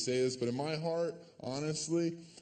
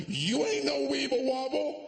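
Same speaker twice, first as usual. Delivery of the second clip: mocking yell; 12 semitones higher than usual. Mock boyfriend taunts the girlfriend he pushed over, yelling. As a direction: jeer it at the fallen girlfriend. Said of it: "be like".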